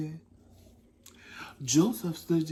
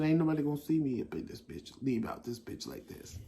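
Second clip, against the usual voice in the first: hushed mutter; 7 dB quieter than usual. Retold or imitated. Sneaking out of the bathroom.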